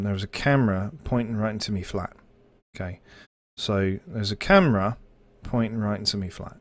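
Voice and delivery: English key phrase in a light English accent. Shown as a demonstration. Streamer demoing his accent-switch phrase, English accent. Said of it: "my English key phrase is".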